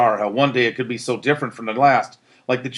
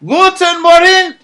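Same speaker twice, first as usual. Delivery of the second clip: very high, loud, slow exclamation; exclaiming with joy; 10 dB louder, slower than usual. A narrator's reading. Berger stepping over the line, exclaiming joyfully. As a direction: greet the new world in wonder. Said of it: "exclaimed Berger".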